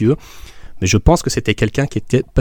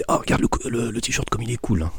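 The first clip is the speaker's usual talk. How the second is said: hushed aside behind the counter; whispering; 8 dB quieter than usual. Retold or imitated. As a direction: whisper it to a friend. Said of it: "en faisant du coude".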